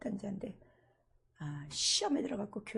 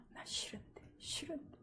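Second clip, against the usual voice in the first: whisper; 7 semitones higher, 10 dB quieter than usual. Retold or imitated. Ghost refusing to leave.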